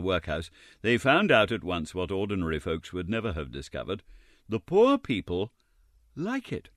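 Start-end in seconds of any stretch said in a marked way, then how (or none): 4.5-6.7 sarcastic. A narrator's reading. Ironic narrator aside.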